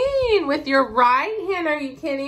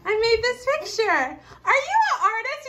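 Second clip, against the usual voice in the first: higher, amazed mock baby; proud baby voice; 10 semitones higher than usual. Mom voices her toddler admiring his own drawing. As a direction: sound thrilled with your own picture. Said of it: "He's looking at it like".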